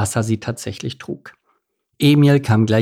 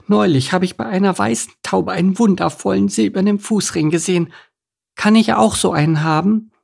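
put-on high, sweetly pleading little girl; wide-eyed duckling girl, pitched high; girlish duckling, wishing; 9 semitones higher than usual. Voices girlish Elisabeth.

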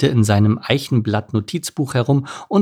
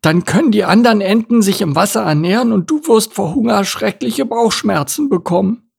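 high-voiced, careful turtle character; high turtle voice, agreeing and advising; cautious turtle; 10 semitones higher, 3 dB louder than usual. Voices turtle listing dangers.

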